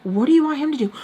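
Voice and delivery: loud, high retold question; exasperated retort. Answering the order to get dad, exasperated loud retort. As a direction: fire the question back loudly.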